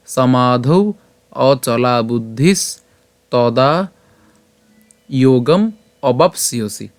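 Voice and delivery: slow, measured Sanskrit verse recitation, vowels drawn out; pure Sanskrit pronunciation. Shown as a demonstration. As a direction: recite the shloka slowly, syllable by syllable. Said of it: "আবার প্রথম থেকে উচ্চারণ করি". (ধীরে, শুদ্ধ সংস্কৃত উচ্চারণে শ্লোক পাঠ)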